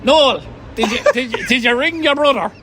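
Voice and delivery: hammed-up mum voice checking up; nagging mum. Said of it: "the mom would just ring".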